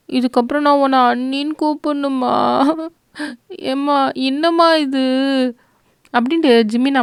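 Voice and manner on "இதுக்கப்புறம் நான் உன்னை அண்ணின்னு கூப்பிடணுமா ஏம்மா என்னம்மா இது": high-pitched whiny objection; protesting disbelief. A narrator's reading. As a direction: complain to Mum in disbelief. Girl protests new title to mother.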